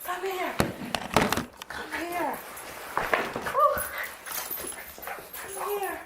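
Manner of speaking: high soft coaxing call. Real, not acted. Calling pet softly.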